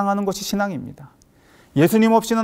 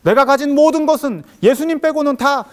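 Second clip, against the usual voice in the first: high, loud zealous proclamation; acted-out fervor, rising to a loud finish; fervent declaration; 6 semitones higher, 5 dB louder than usual. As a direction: declare it like a convert on fire. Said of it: "막 이러면서 돌아다닙니다".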